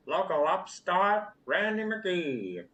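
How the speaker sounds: put-on old-timey radio announcer, high and loud; crackly AM radio sportscaster; 1950s narrator. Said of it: "you can hear the old-timey announcer on the radio, crackly".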